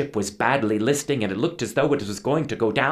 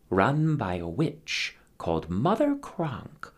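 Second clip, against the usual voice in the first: plain reading voice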